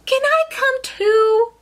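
very high, hopeful little voice; eager bird. Acted. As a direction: ask high and pleading. Bird asks to join.

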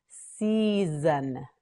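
"Season" with long stretched syllables; slow modelling. Shown as a demonstration. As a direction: draw "Season" out slowly. Teacher models word slowly.

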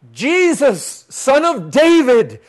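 loud, high, drawn-out shout; full-force cry for help. Acted. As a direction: call desperately to someone far off. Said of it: "they cried out all the more".

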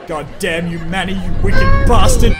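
loud hammed-up curse; furious shout. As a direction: bellow it at the villain. Mock rage at Manny.

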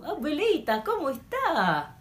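high, stretched sing-song Argentinian Spanish; Argentinian accent. Shown as a demonstration. Teacher greeting grandmother as a demo, sing-song Argentinian accent. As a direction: swoop up and down, Argentinian style. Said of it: "yan ang ating Argentinian".

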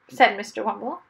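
plain reading voice

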